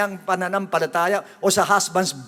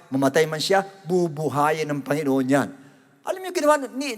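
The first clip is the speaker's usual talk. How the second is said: ordinary talk, nothing marked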